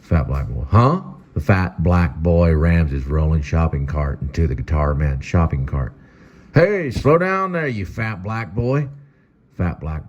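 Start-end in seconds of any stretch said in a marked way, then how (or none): 0.7-1.0 high, startled huh; a kid caught off guard, pitched up; confused huh. Acted. Boy reacting to the taunt with a high startled huh. 6.5-8.9 high, slow, drawn-out scold. Acted. Guitar Man slowly scolding the kid who rammed his cart.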